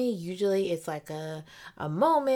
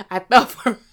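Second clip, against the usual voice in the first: laughing; 5 semitones higher, 11 dB louder than usual. Real, not acted. Laughing at herself.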